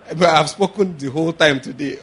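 normal talking voice

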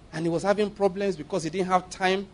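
ordinary talk, nothing marked

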